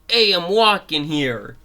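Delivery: loud, high Brooklyn shout; Brooklyn accent. Acted. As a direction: call it out like an indignant Brooklyn pedestrian. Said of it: "Fangy's a Brooklyn accent".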